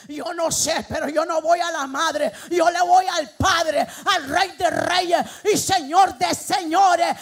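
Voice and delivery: loud, emphatic proclamation, slowing at the end; full-force preaching. Real, not acted. Preacher declaring allegiance to the Father, full-force preaching. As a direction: proclaim it hard, stretching the final titles.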